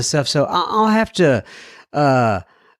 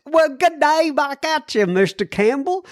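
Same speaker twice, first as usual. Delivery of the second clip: much higher mock Australian reply; Australian accent; 12 semitones higher than usual. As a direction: return the greeting in a hammed-up Aussie accent. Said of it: "here's another Aussie".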